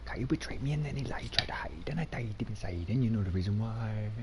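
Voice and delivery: husky whispered lament; whispering. Pretend. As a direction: breathe it like a secret. Whispered sad rhyme.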